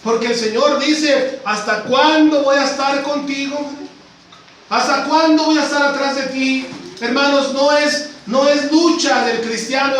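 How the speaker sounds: rising, insistent pulpit cadence, pressing each phrase; preaching at full force. Acted. Preacher urging the congregation, at full preaching force. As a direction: preach it hard, driving every line home.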